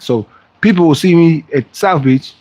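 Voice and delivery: normal talking voice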